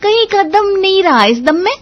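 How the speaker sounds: high, loud mocking saas imitation; exaggerated fault-finding saas impression; mimicking mother-in-law. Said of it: "mummy जी तो सूंघते ही कहेंगी".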